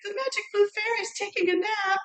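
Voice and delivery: very high-pitched tiny fairy voice; high squeaky fairy. Acted. Sister mocking the fairy's excuses, high squeaky voice. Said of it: "the high squeaky voice would say".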